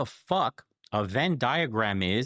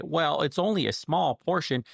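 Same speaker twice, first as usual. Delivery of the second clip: mock objector; 7 semitones higher than usual. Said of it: "So you could say".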